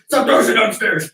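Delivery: loud scolding shout; yelling at kids. Retold or imitated. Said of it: "They wouldn't listen".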